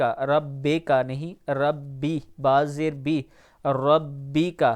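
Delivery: ordinary delivery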